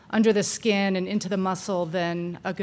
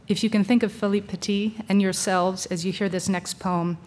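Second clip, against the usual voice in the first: normal talking voice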